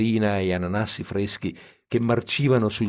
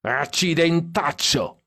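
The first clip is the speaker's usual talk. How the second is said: higher, slow mock drunken shout; drunken yell; 8 semitones higher, slower than usual. Drunk Stan yells from the back seat, slow and high. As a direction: stretch out the curse like a happy drunk. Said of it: "urlò Stan dietro".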